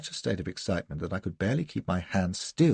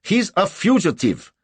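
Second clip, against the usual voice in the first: loud, high-pitched accusation; angry demand; 12 semitones higher, 9 dB louder than usual. A narrator's reading. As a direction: denounce him angrily. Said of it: "Angrily, he demanded".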